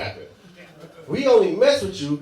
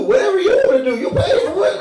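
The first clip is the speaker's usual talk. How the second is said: mock deference; 12 semitones higher, 8 dB louder than usual. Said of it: "She had the nerve to ask us".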